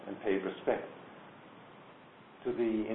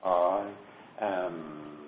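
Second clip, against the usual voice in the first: "I", a long pause, then "am" falling lower; pause between I and am; 3 semitones lower than usual. Shown as a demonstration. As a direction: split "I" and "am" with a clear, deliberate gap. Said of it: "A distinct pause".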